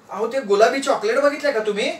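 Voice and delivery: high-pitched wifely query; lightly flustered wife; anxious wife. Acted. Wife searching for missing chocolates.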